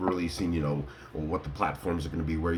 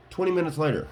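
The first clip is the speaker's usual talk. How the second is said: high announced time skip; dramatic time-card announcement; 8 semitones higher, faster than usual. Acted. Marking the long wait for a jump, dramatic announcement. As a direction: call it out high like a caption card. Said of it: "Let me say it".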